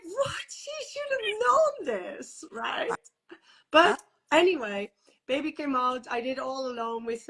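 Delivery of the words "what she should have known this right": high, crying shout of disbelief; outraged disbelief. Real, not acted. Mother learning the midwife should have known, outraged cry. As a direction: cry out in real indignation.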